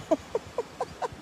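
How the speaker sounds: soft, high, breathless laughing fit; real fit of the giggles; helpless laughter. Real, not acted. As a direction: laugh uncontrollably under your breath. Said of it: "I just can't stop laughing".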